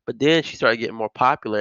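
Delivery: ordinary talk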